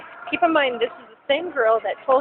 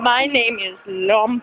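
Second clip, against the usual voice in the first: cartoon voice, slower. Copying the cartoon voice.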